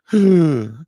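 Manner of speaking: sharp, audible intake of breath; dramatic gasp. Acted. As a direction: gasp in mock shock. Mock shock after acted quote.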